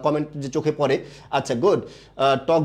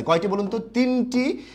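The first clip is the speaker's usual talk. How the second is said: ordinary talk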